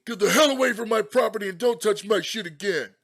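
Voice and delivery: high, very loud irate scream; outraged full-volume shouting; angry screaming. Acted. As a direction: scream at a trespasser to back off. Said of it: "He continued to scream at me".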